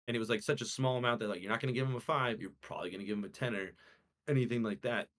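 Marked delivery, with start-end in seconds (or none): none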